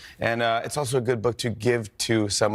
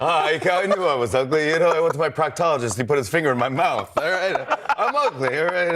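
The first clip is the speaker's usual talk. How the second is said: higher, laughing stand-up patter; self-deprecating comic, selling each line; Rodney Dangerfield impression; 4 semitones higher than usual. Kid doing Rodney bits for his grandparents, higher and laughing. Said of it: "I would do Rodney impressions".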